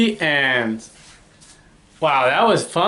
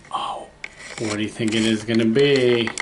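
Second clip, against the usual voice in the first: usual speaking voice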